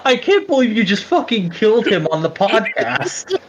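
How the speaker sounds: real high-pitched laughing incredulity; laughing disbelief. Real, not acted. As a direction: say it while cracking up. Co-hosts crack up mid sign-off.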